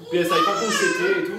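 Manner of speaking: ordinary delivery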